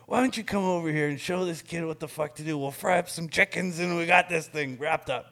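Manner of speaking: high, loud mocking boss voice on the phone; imitating angry boss. Client on the phone ratting to Don, mock loud boss. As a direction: ham up the furious client.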